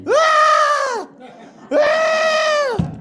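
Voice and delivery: mock panicked yelling with a laugh in it; imitated scream. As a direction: let out a terrified howl like the running friend. Friend runs off screaming high.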